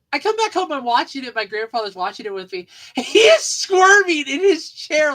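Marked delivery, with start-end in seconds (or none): 2.8-5.1 laughing. Real, not acted. Laughing while telling.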